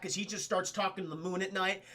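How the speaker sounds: ordinary delivery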